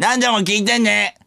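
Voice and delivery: put-on very high, crude child-star caricature; 芦田愛菜. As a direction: squeak it out as a fake little girl. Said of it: "芦田愛菜さんのモノマネのモノマネ？". (芦田愛菜のものまねのものまね、甲高く)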